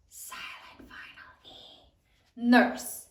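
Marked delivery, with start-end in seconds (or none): 0.1-1.8 soft whisper for the silent letter. Shown as a demonstration. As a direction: breathe it like a secret.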